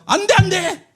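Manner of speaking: high sharp cry of warning; 다급한 외침. Retold or imitated. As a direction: stop a child from danger. 아이에게 위험하다고 소리침. (아이를 말리는 다급한 외침)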